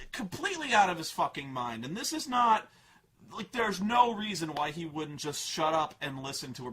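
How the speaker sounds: normal talking voice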